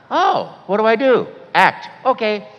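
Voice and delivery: high, loud eager back-and-forth; eager exchange. Retold or imitated. Actor eagerly asking his friend about the audition, loud. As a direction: fire the lines off eagerly and loud. Quick retold phone exchange.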